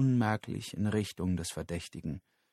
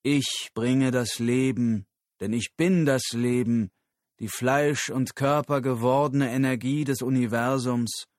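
louder, raised, solemn declaration; proclaiming guru; 3 semitones higher, 5 dB louder than usual. Acted. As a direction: address the crowd like a prophet. Guru verkündet vor Publikum. (feierlich verkündender Guru)

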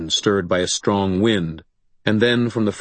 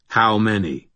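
ordinary delivery

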